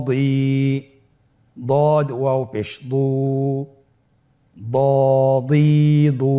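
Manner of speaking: measured recitation with long held vowels; spelling drill. Shown as a demonstration. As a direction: spell out each letter, then stretch the syllable. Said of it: "আমার সাথে বলুন".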